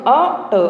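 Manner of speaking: అ ట split into two syllables, a then ʈa; sounding out. Shown as a demonstration. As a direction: chunk అ ట syllable by syllable. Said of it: "ఎలా పలకాలో తెలుసు కదా".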